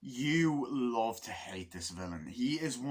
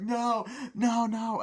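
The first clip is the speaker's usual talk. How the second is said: high cries of no; imitated horrified bystanders; acted panicked plea; 10 semitones higher, 5 dB louder, much slower than usual. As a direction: beg in mock terror. Acting pleas, gun to child's head.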